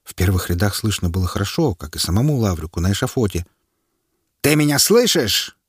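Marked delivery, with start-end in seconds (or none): 4.4-5.5 high, loud call; officer's shout. A narrator's reading. The officer shouts to the prisoner on the scaffold. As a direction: shout to rouse him. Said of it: "выкрикнул военный".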